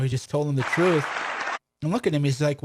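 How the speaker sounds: ordinary talk, nothing marked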